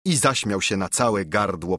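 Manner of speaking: usual speaking voice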